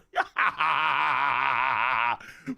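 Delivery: exaggerated ha-ha-ha guffaw; big drawn-out laugh. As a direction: throw out a sustained hammed-up laugh. Acted laugh after manga line.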